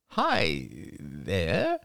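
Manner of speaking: drawn-out, disapproving greeting; a cool, unwelcoming hello; slow disapproval. Acted. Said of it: "Crystal's voice was slow to express her disapproval".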